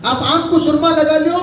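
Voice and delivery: normal talking voice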